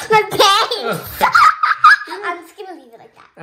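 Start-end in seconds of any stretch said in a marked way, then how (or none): 0.1-2.3 laughing shout. Real, not acted. Laughing at her messed-up hair.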